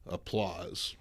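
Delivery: usual speaking voice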